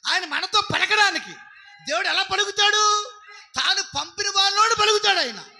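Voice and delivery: loud, high-pitched preacher's cry to the congregation; fiery preaching. Real, not acted. Preacher exhorting his congregation, shouting loud and high. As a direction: drive the message home at full force.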